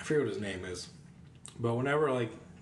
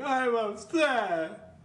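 high, slow whiny whimper; 11 semitones higher, much slower than usual. Retold or imitated. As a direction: whine it like a sulky kid. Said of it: "he just sounds like a whiny little bitch".

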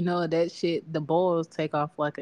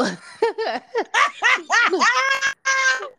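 big high burst of laughter; real shrieking laugh; loud laugh; 9 dB louder than usual. Real, not acted. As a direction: cackle out loud. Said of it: "Mind you".

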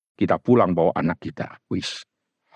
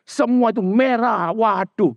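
high, fed-up mock mother listing what she gave; mother scolding her child over bad grades, high and whiny; ranting mother; 13 semitones higher than usual. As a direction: complain like an exasperated mom. Mother despairs at red grades.

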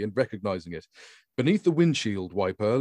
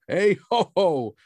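high, louder jolly ho ho; jolly chortle; 8 semitones higher, 6 dB louder, slower than usual. A narrator's reading. Grand chortling at the man, high and loud. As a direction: chortle it merrily. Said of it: "rounded it off with a jolly chortle".

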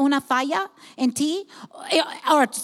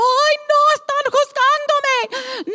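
much higher, whiny mock voice, slowing down; mock insecure God; 11 semitones higher, slower than usual. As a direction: whine it like a nervous, touchy God. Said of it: "Él no va a estar como". (voz burlona de Dios inseguro)

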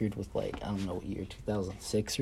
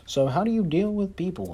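high, nasal-free prim interviewer voice, a touch mocking; mock the snooty interviewer's question; imitating interviewer; 9 semitones higher than usual. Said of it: "he's gonna be like".

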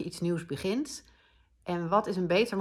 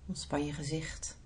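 soft spa whisper; 4 semitones lower, 9 dB quieter than usual. Acted. Relaxation cue, unvoiced.

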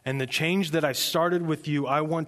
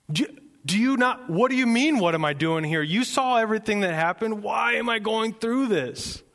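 high, incredulous complaint; worked-up, exasperated Elijah; indignant protest; 9 semitones higher, 4 dB louder than usual. Acted. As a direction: protest the unfairness to God. Acting out Elijah's protest to God.

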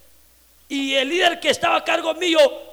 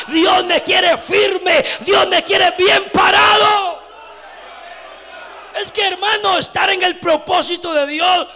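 full-force Pentecostal pulpit cry; fiery preaching; 2 semitones higher, 7 dB louder than usual. Acted. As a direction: preach it to the back row.

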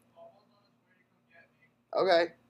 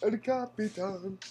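drawn-out call; 2 semitones higher than usual. Pretend.

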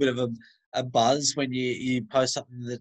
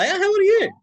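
much higher and louder, startled question; shocked at the answer; surprised; 9 dB louder, faster than usual. Real, not acted. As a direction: blurt it out in disbelief. Surprised question about age.

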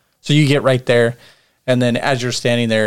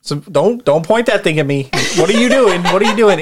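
panicked trooper; 5 semitones higher than usual. Acted. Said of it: "and he's like".

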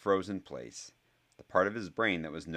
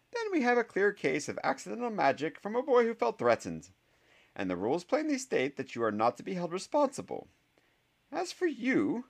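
much higher, dry and reproachful; a sceptical teacher, higher and clipped; stern professor; 5 dB louder than usual. Acted. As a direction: scold the boy with crisp authority. Professor Sprout voiced by narrator.

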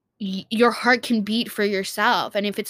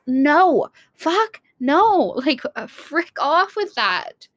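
emphatic dismissal; 6 semitones higher, much slower than usual. Real, not acted. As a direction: brush it off hard, half laughing. Rejecting Valentine's Day hype.

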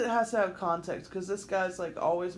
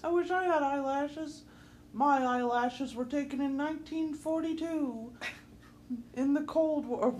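lightly voiced old woman, higher; jealous old woman; 6 semitones higher than usual. Joining in as an envious old woman, raised voice. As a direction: put on a bit of an old lady. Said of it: "old women were always jealous of me".

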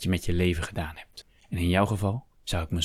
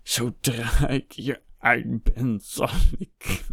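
high, drawn-out outraged vow; slow, seething, wounded pride; indignant outburst; 6 semitones higher, 3 dB louder, much slower than usual. A narrator's reading. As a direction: declaim the threat until the breath fails. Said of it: "Zijn adem stokte".

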